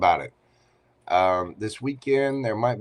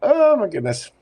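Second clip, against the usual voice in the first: amused exclamation; 11 semitones higher, 7 dB louder than usual. Real, not acted. After laughing at himself.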